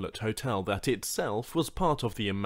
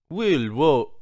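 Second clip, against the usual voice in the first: Banu greeting; 4 semitones higher, 9 dB louder than usual. Acted. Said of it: "greeting the two other males in Banu".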